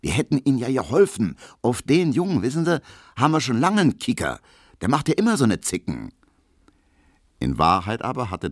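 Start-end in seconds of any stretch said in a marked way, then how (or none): 0.0-6.1 quick, higher-pitched Berlin chatter; confidential soldier. A narrator's reading. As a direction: egg him on as an ally. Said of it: "meint der Soldat vertraulich". (vertraulich und eifrig)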